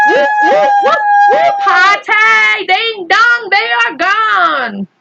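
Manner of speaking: very high whooping party cheer. Acted. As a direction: whoop it up like a crowd celebrating. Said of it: "tremendous burst of cheering".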